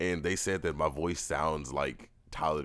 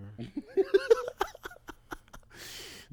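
squealing laugh, pitched way up; high shrieking laugh. Real, not acted. Cracking up at his friend's puddle joke, high shriek of laughter.

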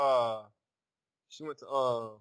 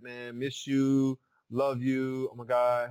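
slow, louder, gushing "Man, miss you, love you"; gushing loud messages; 5 dB louder, much slower than usual. Retold or imitated. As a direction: drag out the love like a homesick friend. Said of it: "we were like".

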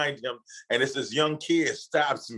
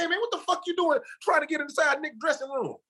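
bodyguard's challenge; 14 semitones higher than usual. Retold or imitated. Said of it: "He said".

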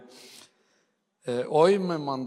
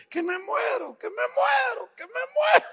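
wailing woman. Retold or imitated. Hammed-up woman wailing she is dying in the emergency room. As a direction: wail it like a panicked patient, far up in pitch. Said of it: "ella entra y dice".

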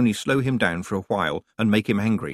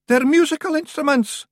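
very high, louder, squeaky little rogue; Feegle voice; 5 dB louder than usual. A narrator's reading. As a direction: pipe it up like a tiny Scot. Feegle voice in the story.

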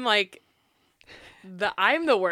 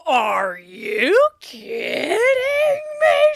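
shrieking in disbelief; outraged shout; 14 semitones higher, 12 dB louder, much slower than usual. Retold or imitated. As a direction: yell it in outrage. Retold outburst at Kathy.

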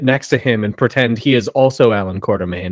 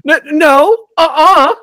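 high, louder stammering "no, uh-uh"; mock German denial; 14 semitones higher, 5 dB louder than usual. Cohost voices the German paper denying blame, high and flustered. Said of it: "the Germans say".

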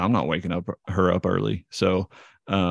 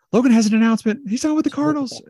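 very high, loud mom voice; excited mom; 6 dB louder, faster than usual. Retold or imitated. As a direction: announce it to the room like a proud mother. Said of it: "My mom goes".

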